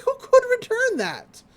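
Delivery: high, loud disbelieving squawk; incredulous protest. Retold or imitated. After dodging a flaming serve, a high incredulous squawk. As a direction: squeal it out in disbelief.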